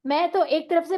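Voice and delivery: ordinary talk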